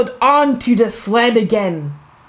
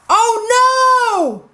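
high, alarmed cry; yelled oh no; 12 semitones higher than usual. Acted. Peppa bouncing off the sled, yelling in alarm. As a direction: yell it as you bounce off the sled. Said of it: "yelled Peppa".

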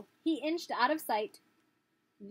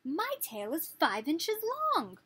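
robin; 7 semitones higher, 4 dB louder, slower than usual. Acted. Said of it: "said the robin".

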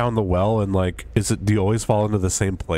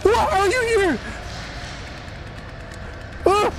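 high frightened yelling; real fright, voice very high; panicked shrieks. Real, not acted. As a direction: shriek in alarm at the killer. Killer appears, panicking.

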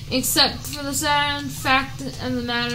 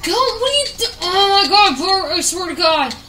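1 exasperated; 5 semitones higher, 4 dB louder, faster than usual. Real, not acted. Frustrated at horse.

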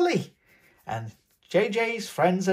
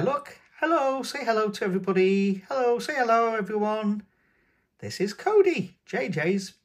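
excited reveal; 7 semitones higher than usual. Real, not acted. Unboxer shows Cody to the young viewers, excited and high.

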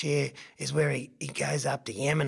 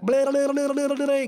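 high, loud tongue-flapping babble; silly noises; 10 semitones higher, 6 dB louder than usual. Acted. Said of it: "making silly wombat noises".

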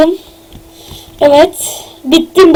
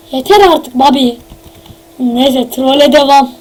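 ordinary talk

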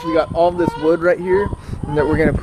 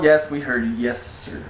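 normal talking voice

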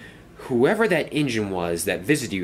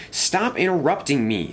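mildly cross, higher pitch; a touch of annoyance in a raised pitch; 6 semitones higher than usual. A narrator's reading. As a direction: object to being interrupted, pitched up. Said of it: "said Dennis crossly".

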